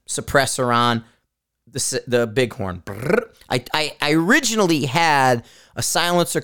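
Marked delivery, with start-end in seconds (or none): 2.9-3.3 short rolled brr; lip-trill brr. Real, not acted. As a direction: blow a quick brr after fumbling words. Resets after fumbling the words.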